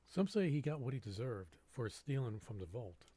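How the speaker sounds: ordinary delivery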